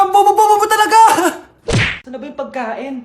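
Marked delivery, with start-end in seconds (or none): 0.0-1.0 loud exasperated scold; mother yelling at her child; scolding shout. Acted. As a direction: berate the kid at full volume.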